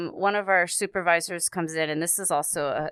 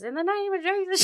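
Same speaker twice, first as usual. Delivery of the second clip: high-pitched mock southern preacher; southern accent. Pastor praying over the possessed girl, in high southern accent. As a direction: imitate a southern pastor casting out evil, pitched way up. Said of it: "She got this southern accent".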